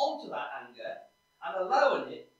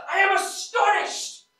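high, loud, slow indignant cry; Paul erupting in disbelief at his letter; astonished outburst; 9 semitones higher, 13 dB louder, slower than usual. Retold or imitated. Paul dictating to the Galatians, astonished and indignant. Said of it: "He said".

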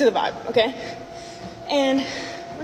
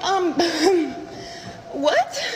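incredulous laugh; 4 semitones higher than usual. Retold or imitated. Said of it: "And I was like".

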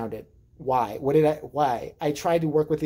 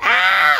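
put-on baby scream; 3 semitones higher, 10 dB louder than usual. Brother screams.

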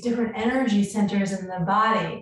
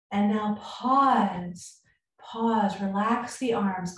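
guided meditation; 4 dB quieter than usual. Acted. Leads a short breathing pause.

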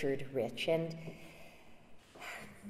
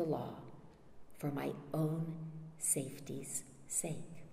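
softer, fading almost to a whisper; hushed conclusion; 2 semitones lower, 10 dB quieter than usual. Acted. More admits to Roper his own need of law, hushed. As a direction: let the last words sink away quietly.

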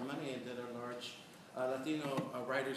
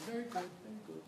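higher, softer imitation of Irene's praise; imitating Irene; 7 semitones higher, 4 dB quieter, faster than usual. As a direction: echo the teacher's praise in her voice. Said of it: "Remember I used to do".

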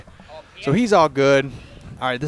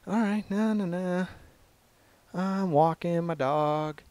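higher, slow-drawn mock humming stroll; carefree dog walker; 6 semitones higher, slower than usual. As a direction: voice an oblivious stroller, pitched up. Voicing lady walking her dog.

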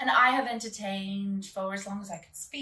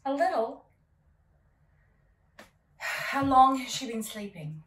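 put-on posh English accent, cool and haughty; 3 semitones higher, 7 dB louder than usual. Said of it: "Your accent".

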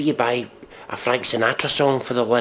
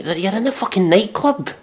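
sarcastic retort; 8 semitones higher than usual. Real, not acted.

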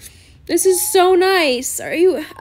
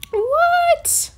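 very high-pitched squeak of shock; real high squeal of surprise; stunned disbelief; 12 semitones higher than usual. Real, not acted. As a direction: squeal the question in amazement. Cannot believe the gift.

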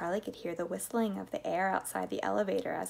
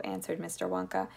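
usual speaking voice

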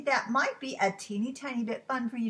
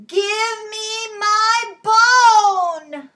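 huge, high, long-held holler; full-volume spooky storybook demand; loudest call; 10 semitones higher, 16 dB louder, much slower than usual. Acted. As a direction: bellow it slowly, sustaining every word. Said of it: "even louder".

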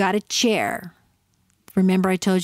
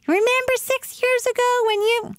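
put-on much higher, squeaky voice of a nagging spouse bringing up old news; mock nagging spouse. Mocking a spouse who drags up old fights, in a high, squeaky voice.

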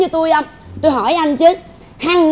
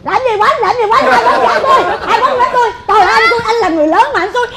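raised-pitch indignant tirade; outraged scolding; 4 semitones higher, faster than usual. Acted. Mother accuses the in-law of hitting her child, outraged. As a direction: lay into him with rapid, heated protests.

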